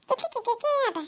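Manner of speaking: high, squeaky fake titter; mocking giggle imitation. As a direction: mimic someone's silly giggle in scorn. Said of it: "నవ్వుడు కూడా ఎట్లా నవ్వుతాంది".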